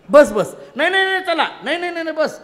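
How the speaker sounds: high, loud, repeated no, no, stay; loud insistent refusal. Acted. Krishna refusing Arjuna's plea to leave, loud and insistent. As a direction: plant your feet and refuse loudly.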